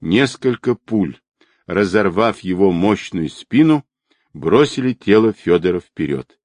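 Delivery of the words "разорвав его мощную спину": ordinary delivery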